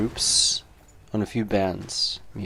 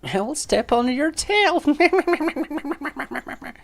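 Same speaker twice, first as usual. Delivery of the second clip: very high, loud crone with a cackle; crazy old lady; 5 dB louder, much slower than usual. Acted. Crazy old lady threatens your tail, high cackling crone. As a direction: cackle like a batty old woman. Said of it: "you crazy old bitch".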